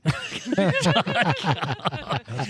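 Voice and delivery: bright burst of laughter; laughing. Real, not acted. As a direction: laugh it off, amused. Laughs after sing-songy spelling.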